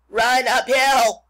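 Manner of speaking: loud, drawn-out sheep call; sheep crying out. A narrator's reading. Sheep advising the runaway pig, loud stretched-out cry. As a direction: holler it slowly as a sheep. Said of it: "cried the sheep".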